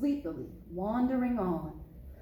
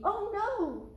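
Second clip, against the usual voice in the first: scared cry; 12 semitones higher than usual. Acted. Cub fears a monster.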